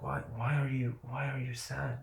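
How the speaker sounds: quiet, hushed, asking himself why he is sad; a soft puzzled murmur to himself; soft self-questioning. Retold or imitated. Talking to himself on the trip, softly puzzled.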